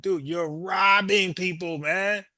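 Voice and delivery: drawn-out disbelieving rebuke; exasperated scolding. Acted. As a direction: scold him like he should know better. Scolding the deported robber.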